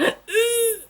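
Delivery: high, squeezed straining sound; imitated straining noise. Mother showing her first wrong push, imitated strain noise. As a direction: mimic bearing down, high-pitched. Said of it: "I think I went, like, something like".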